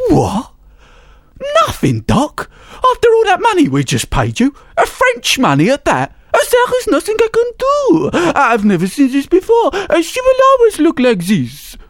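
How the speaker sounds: high, loud squawking father; outraged father. A narrator's reading. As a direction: squawk in disbelief.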